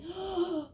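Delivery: sharp, high-pitched stagey gasp; shocked gasp. Acted. Bears shocked at their milk.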